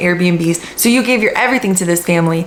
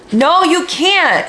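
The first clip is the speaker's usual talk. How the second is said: high, slow, flat denial; emphatic no; 9 semitones higher, much slower than usual. Real, not acted. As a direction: shut the idea down firmly. Rebutting the hopeful quote.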